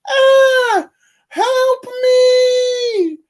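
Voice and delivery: very high, squealing cartoon shriek; mock cry for help. Reviewer voicing York squealing as a hostage, hammed-up shriek. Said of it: "And she's like".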